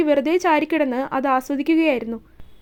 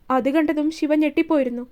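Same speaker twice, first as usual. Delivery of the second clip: usual speaking voice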